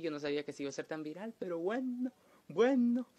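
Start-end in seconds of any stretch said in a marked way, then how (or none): 1.4-3.1 mock high resigned voice.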